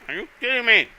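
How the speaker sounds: high, loud disbelief; high incredulous outburst; surprised. Real, not acted. Repeats the line, much higher.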